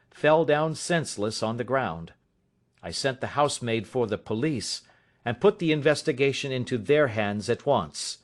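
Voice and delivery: ordinary delivery, nothing marked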